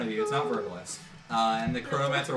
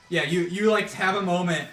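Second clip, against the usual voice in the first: louder, higher, building excitement; louder, higher storyteller rush; excited narration; 7 semitones higher, 8 dB louder than usual. Acted. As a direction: sell the sudden twist with energy. GM excitedly describes sudden vision.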